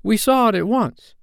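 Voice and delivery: put-on high-pitched childlike eagerness; delighted children agreeing; excited cry. A narrator's reading. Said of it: "they all cried".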